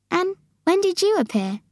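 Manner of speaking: high voice of Sophie; Sophie curious. Acted. Sophie asking when the giant appeared, curious.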